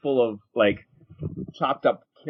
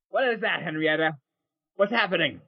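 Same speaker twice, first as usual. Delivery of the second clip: high, alarmed woman's voice; woman with shotgun; 5 semitones higher than usual. Acted. Long-haired woman coming out with a shotgun, high questioning. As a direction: pitch it up and question the pig. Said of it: "just going".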